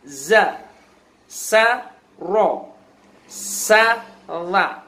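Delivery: each syllable called out clearly, s hissed; reading drill syllables. Shown as a demonstration. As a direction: drill syllables like a Quran teacher. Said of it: "halaman dua puluh dibaca sa". (membaca suku kata dengan jelas)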